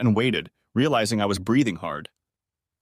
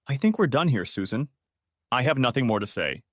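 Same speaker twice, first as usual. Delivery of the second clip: ordinary delivery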